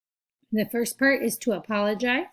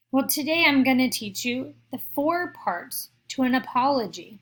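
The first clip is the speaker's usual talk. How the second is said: ordinary delivery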